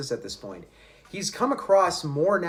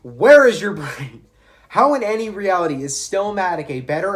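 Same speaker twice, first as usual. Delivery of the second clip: much louder, stretched-out incredulous question; exasperated outburst; 5 semitones higher, 8 dB louder than usual. Real, not acted. Calling out a commenter's opinion.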